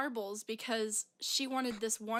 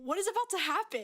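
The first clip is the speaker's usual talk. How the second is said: high, louder laughing alarm; half-laughing retold panic, pitched way up; mock scared; 9 semitones higher, 5 dB louder than usual. Frozen cashier watching a meltdown, mock scared. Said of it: "I was so scared".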